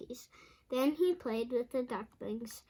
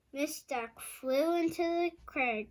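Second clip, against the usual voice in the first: usual speaking voice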